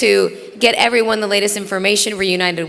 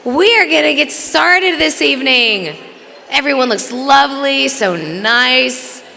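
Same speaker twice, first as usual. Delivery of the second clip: loud, high call over the crowd; yelling to the room; 4 semitones higher, 4 dB louder than usual. Real, not acted. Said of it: "we're supposed to yell".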